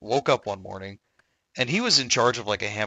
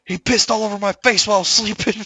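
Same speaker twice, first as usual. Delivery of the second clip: high, loud mock indignation; retold outraged yell; 11 semitones higher, 8 dB louder than usual. Attacker explaining why he beat his roommate, mock outraged. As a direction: imitate a loud, aggrieved complaint.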